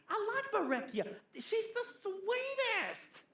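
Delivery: high, chirpy gossip voice, giggling; gossipy church lady. Retold or imitated. Preacher to congregation, mimicking a sweet town gossip, high and giggly. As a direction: coo the names like juicy news.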